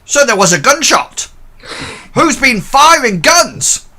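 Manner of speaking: startled porter demanding answers; alarmed old porter. Acted. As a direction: squawk out the question in alarm. Porter Bert alarmed by gunshot.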